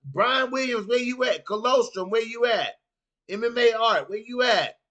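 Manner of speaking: high, loud hype roll call. Real, not acted. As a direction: shout names across the room. Calling out chat names.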